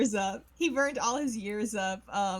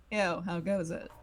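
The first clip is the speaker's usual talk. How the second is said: laid-back dad voice; 5 semitones lower than usual. Acted. Voicing Phoenix greeting.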